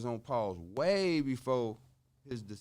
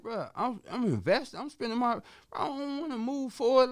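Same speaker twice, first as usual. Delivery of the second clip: higher and louder, pushing the point hard; retold excited pleading; 11 semitones higher, 6 dB louder than usual. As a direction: plead with the partner, worked up. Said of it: "I'm like".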